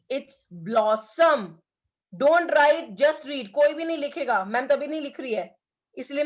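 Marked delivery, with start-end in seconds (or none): 0.1-1.4 "blossom" drawn out very slowly, bl stressed; slow model reading. Shown as a demonstration. Teacher modelling the word "blossom" for the class, very slow. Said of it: "Try to read with me". 2.1-4.4 louder, firm classroom command; firm instruction. Real, not acted. Teacher telling pupils to stop writing, firm. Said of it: "teacher telling class off".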